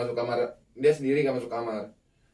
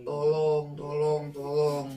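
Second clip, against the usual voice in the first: drawn-out, higher cry for help; imitated plea for help; 4 semitones higher, much slower than usual. Voice below pleads for help amid knocking, imitated pleading. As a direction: mimic an eerie repeated plea. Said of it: "sambil minta tolong".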